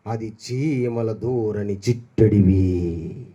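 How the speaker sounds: very slow, low, eerie storyteller voice; a granny starting a ghost tale, slow and low; spooky ghost story. Retold or imitated. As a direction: draw out each word to scare the kids. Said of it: "అని ఒక దెయ్యం కథ చెప్తారు".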